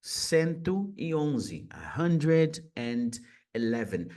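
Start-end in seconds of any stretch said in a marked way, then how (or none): 1.7-4.1 slowed-down "A hundred and eleven", each word pulled apart; slow model repeat. Shown as a demonstration. Teacher slows the number down for learners to copy. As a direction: repeat slowly so students can copy. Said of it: "Note que eu tô usando esse A aqui".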